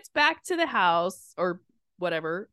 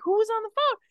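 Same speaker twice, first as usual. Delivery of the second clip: baffled; 11 semitones higher than usual. Retold or imitated. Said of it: "I'm like".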